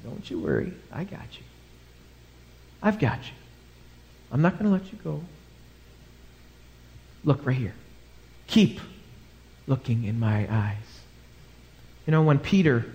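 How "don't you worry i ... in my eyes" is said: soft, tender voice of a comforting father; calm reassurance. Acted. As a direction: speak gently and unhurriedly, as if steadying someone in difficulty. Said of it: "who has this calm look".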